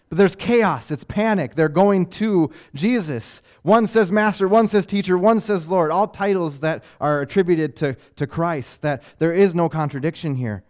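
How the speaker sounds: loud, driving sermon build; fiery preaching. Acted. Preacher driving home the disciples' panic, loud. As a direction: preach it hard to the crowd.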